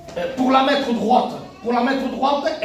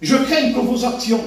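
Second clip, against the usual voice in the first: ordinary delivery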